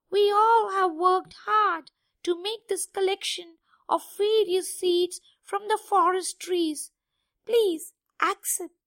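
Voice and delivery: stammering awkward suitor; high, timid squeaky rat voice; nervous, hesitant rat. Acted. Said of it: "spoke hesitantly with a nervous voice".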